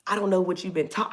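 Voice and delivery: ordinary talk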